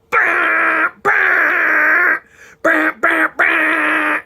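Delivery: mocking a driver leaning on the horn; imitated car horn. Imitating a horn honk at him.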